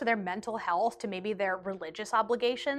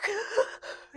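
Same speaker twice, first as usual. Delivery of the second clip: mock sobbing gasp; 11 semitones higher, 3 dB quieter than usual.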